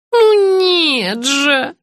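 high, drawn-out cry of despair; despairing exclamation. A narrator's reading. Patient rejecting the guess about the father, despairing. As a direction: wail the denial slowly. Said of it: "с отчаянием воскликнула бедняжка".

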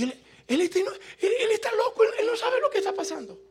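very high, hurried squeaky protest; mock bewilderment. Visitor not knowing what is happening, comically bewildered. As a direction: stammer it high, as if baffled.

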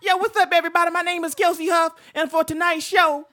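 high, loud stand-up host voice; Kelsey Huff impression. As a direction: perform like a stand-up comic. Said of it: "that was my Kelsey Huff".

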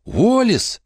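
high joyful call of a name; shouting. A narrator's reading. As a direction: call out to an old friend. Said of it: "закричала она". (радостный крик)

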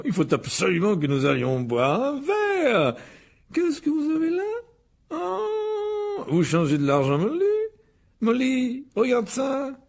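high, enraptured character voice; delighted. Acted. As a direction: gush in wide-eyed wonder, rising very high. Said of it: "s'exclama Monsieur Weasley avec ravissement". (ravissement aigu et émerveillé)

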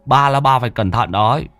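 put-on consoling old exorcist. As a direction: pat their shoulders and reassure them. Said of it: "Lão Tứ vỗ vai an ủi".

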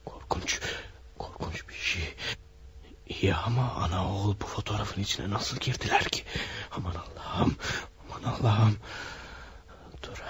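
hushed, fearful whisper; frightened whisper. Acted. Photographer alone with ghostly photo, fearful whisper. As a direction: breathe it out in dread.

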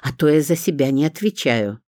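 usual speaking voice

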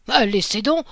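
loud dismissive cry; exclamation. A narrator's reading. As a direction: wave their fears away loudly. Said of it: "s'écria l'empereur". (exclamation forte et dédaigneuse)

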